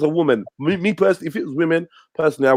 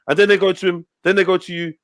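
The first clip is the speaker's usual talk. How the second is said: usual speaking voice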